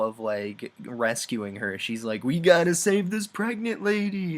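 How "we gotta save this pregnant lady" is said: higher, louder hammed-up alarm; mock urgent cry. Sorceress suddenly remembering the pregnant lady, mock urgent cry. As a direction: blurt it out like a sudden panic. Retold frantic game scene.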